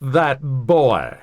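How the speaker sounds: a light, mocking Southern drawl on "That boy."; a hint of a snarky Southern teacher; snarky Southern accent. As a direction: drag out "That boy." like a dramatic Southern gossip, lightly. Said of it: "in the most dramatic, snarky Southern accent you can imagine".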